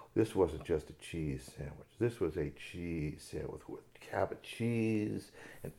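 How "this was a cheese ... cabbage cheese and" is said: slow hushed whisper; awed whisper. A narrator's reading. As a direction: whisper it like a treat. Savoring description, whispered.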